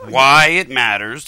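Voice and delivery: WHY IT MATTERS drawn out word by word; slowly. Real, not acted. Host reminding his rambling co-host of the question, slowly. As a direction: spell it out at a crawl. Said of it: "let's say it slowly".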